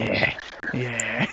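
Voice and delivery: very soft, toneless agreement; kobold agreeing. Acted. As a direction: murmur it back under your breath. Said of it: "And he's like".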